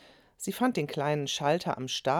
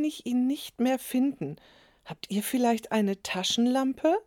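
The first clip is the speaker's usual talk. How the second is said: higher, lightly voiced old lady; a hint of an old lady; 8 semitones higher than usual. A narrator's reading. Old neighbour apologises and asks the children for a torch. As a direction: apologise politely as an elderly neighbour.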